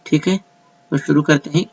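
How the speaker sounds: ordinary talk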